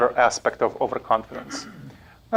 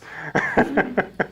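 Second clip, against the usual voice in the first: breathy rising laugh; airy laugh; 7 semitones higher than usual. Real, not acted. Lecturer to class, breathy laugh. Laugh after audience nod.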